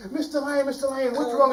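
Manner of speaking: high, worried little voice; concerned mouse. Acted. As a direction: call out to the lion anxiously. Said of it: "came running up to the lion and said".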